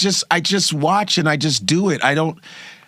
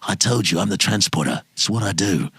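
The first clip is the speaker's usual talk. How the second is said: Jason Statham impression; 7 semitones lower than usual. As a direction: play it like Statham, low and cool. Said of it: "If I do Jason Statham".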